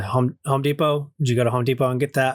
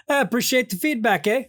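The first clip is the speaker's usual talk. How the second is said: mock Canadian; 8 semitones higher than usual. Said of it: "The, the neighbor yells".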